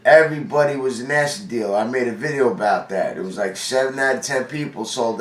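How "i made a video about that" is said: ordinary talk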